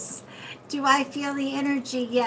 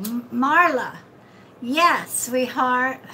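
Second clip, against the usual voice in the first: normal talking voice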